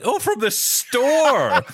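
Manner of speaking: imitating wife's teasing, high and drawn out; mock astonished teasing. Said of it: "you've become all British".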